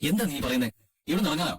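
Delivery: can't believe she suggested leaving; shocked and cross. Acted. Husband hearing his wife wants to move out, shocked and cross. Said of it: "ഇങ്ങനെ ദേഷ്യപ്പെടല്ലേ".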